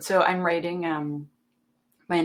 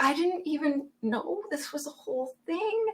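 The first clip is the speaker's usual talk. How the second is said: much higher, softer, baffled; bewildered protest; 10 semitones higher, 6 dB quieter than usual. Retold or imitated. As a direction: plead innocent in surprise. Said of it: "I'm like".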